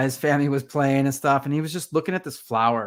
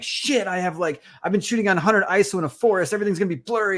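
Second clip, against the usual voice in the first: higher, rushed flustered panic; panicked photographer; 7 semitones higher, faster than usual. Retold or imitated. Realizing the forest photos will blur, fast panicked ramble. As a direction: blurt it fast as the shots go wrong. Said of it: "And you're like".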